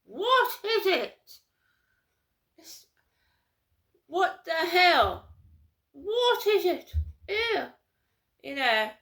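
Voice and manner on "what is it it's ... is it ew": high, loud, slow posh horror with a laugh; Camilla impression. Mocking Camilla sneering at Diana, hammed-up posh shriek. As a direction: drag it out like an appalled royal. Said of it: "and Camilla going".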